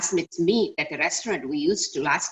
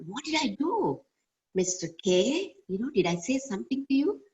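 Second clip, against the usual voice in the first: higher-pitched, mildly taken aback; stunned retold question; 7 semitones higher than usual. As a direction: ask it high, as if stunned and puzzled. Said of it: "I was stunned".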